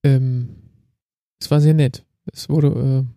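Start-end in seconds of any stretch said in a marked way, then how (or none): none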